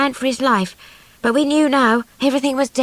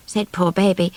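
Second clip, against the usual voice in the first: ordinary delivery, nothing marked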